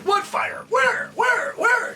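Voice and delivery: high, loud eager shouts; high, loud, excited clamor; excited crew shouting. Retold or imitated. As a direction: crew on headsets clamoring to see the fire.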